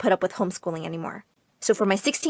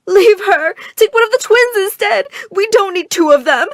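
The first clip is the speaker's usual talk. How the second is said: high, loud panicked mother; frantic loud plea; 9 semitones higher, 7 dB louder than usual. Acted. Mother shrieking at the robbers to take the twins, panicked. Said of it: "Mama started to cry loudly".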